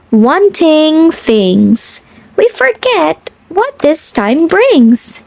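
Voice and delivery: ordinary delivery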